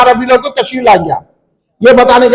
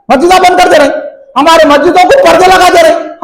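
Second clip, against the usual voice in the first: impassioned preaching; 4 semitones higher than usual. Acted. Impassioned grievance at full force.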